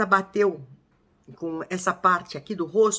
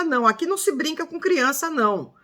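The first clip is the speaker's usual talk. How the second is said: ordinary delivery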